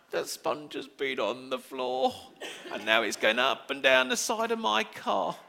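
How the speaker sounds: laughing, dismayed dad watching his car; half-laughing despair over the car; mock horror. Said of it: "it's like".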